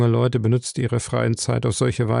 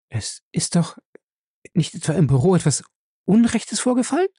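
höher werdende, bange Frage; ängstlich fragend; 6 semitones higher than usual. Acted. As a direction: sie fürchtet das Schlimmste für Ray. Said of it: "fragte sie ängstlich". (Ella fragt Johnson ängstlich nach dem Büro)

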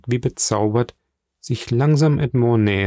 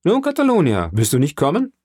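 calling over; 6 semitones higher, 3 dB louder than usual. A narrator's reading. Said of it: "zu dem jungen Mann gewendet".

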